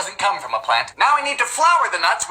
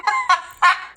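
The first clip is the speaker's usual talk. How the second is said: high laugh; 9 semitones higher than usual. Real, not acted. Baker mixing meatloaf by hand, high laugh. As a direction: laugh in a higher pitch.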